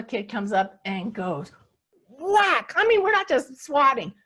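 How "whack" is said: whack. Retold or imitated. Kid swats the dogs again.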